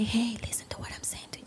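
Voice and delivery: quick, hushed whisper. Shown as a demonstration. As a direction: whisper it as if one-on-one with a child. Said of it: "lower your voice and say".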